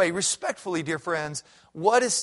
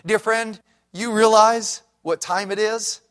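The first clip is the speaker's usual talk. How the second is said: ordinary talk, nothing marked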